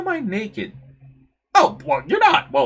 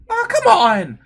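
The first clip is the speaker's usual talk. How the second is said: exasperated protest; 12 semitones higher, 6 dB louder, slower than usual. Acted. Protesting the tavern's rules.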